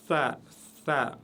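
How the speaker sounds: "Tha" with the tongue between the teeth; Arabic tha. Shown as a demonstration. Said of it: "toujours légère".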